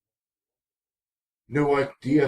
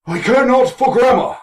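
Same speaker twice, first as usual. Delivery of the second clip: lofty pompous declaration, higher and louder; mock haughty proclamation; 10 semitones higher, 5 dB louder than usual. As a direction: proclaim it like a snooty lord. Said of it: "mock grand declaration".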